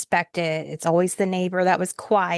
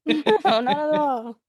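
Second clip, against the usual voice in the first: laughing; 7 semitones higher, slower than usual. Real, not acted. Joking denial while laughing.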